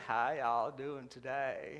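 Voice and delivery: put-on low, very slow old-woman voice; ancient waitress. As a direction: creak it out like a toothless old lady. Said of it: "she says".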